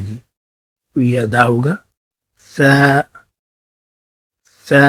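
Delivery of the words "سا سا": سا a sharp s, the tongue tip at the upper teeth; Arabic sin-a demo. Shown as a demonstration. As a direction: hiss سا cleanly from the teeth. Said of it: "زبان کی نوک دانتوں کے اوپر لگے گی".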